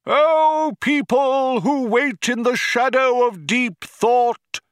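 high, ringing crowd oratory; crying out. A narrator's reading. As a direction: proclaim it grandly to a vast crowd, slowly. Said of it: "he cried out".

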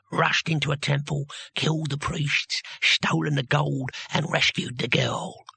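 put-on slow, lightly squelchy old voice. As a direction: savour each deed slowly. Captor lists heroics.

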